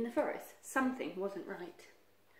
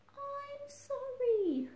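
high, very slow plaintive apology; remorseful Badger calling out; sorrowful apology; much slower than usual. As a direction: stretch it out in a small, pleading voice.

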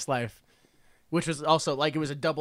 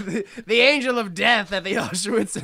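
ordinary talk

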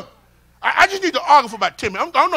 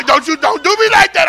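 ordinary talk, nothing marked

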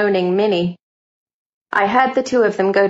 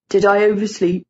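put-on drowsy young man. A narrator's reading. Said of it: "It was a male voice".

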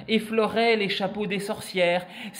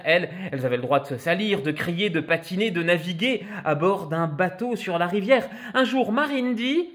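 ordinary delivery

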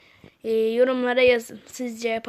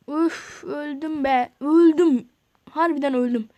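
slow "üf" sighed, then louder "öldüm"; dismayed groan; 6 dB louder, slower than usual. Real, not acted. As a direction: let the loss hurt. Character dies in game.

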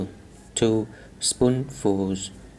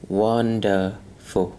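slow wonderful; much slower than usual. Shown as a demonstration. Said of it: "demo of wonderful".

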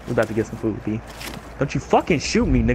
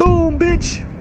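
high triumphant yell; excited gloating; 3 dB louder than usual. Real, not acted. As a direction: gloat after the kill. Gets the kill, celebrates.

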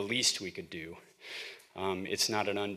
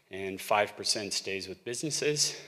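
normal talking voice

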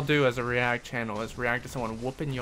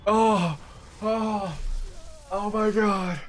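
panicked oh my god; 7 semitones higher, much slower than usual. Real, not acted. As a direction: cry out in tense alarm. Tense boss fight moment.

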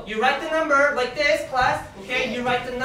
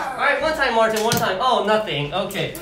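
ordinary delivery

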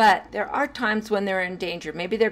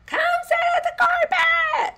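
high, loud shouted order; mock teacher shout; 5 dB louder than usual. Shown as a demonstration. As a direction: bark it like a fed-up teacher. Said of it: "instead of saying".

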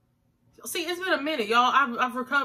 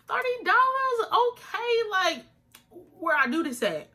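excited; 12 semitones higher, slower than usual. Retold or imitated. Retold excited reaction.

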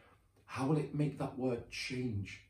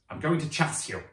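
1 playful chase threat; 6 semitones higher, 7 dB louder than usual. Pretend.

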